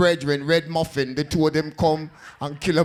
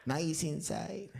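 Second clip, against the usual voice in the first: beach hustler; 3 semitones lower, 6 dB quieter, slower than usual. Retold or imitated. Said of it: "counting money".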